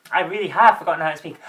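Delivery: ordinary talk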